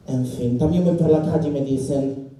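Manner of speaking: usual speaking voice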